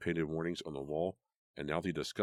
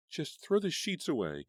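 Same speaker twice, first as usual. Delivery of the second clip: high, slow, near-hysterical plea; hysterical cry; 11 semitones higher, 4 dB louder than usual. A narrator's reading. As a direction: beg it in rising panic. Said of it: "still hysterical".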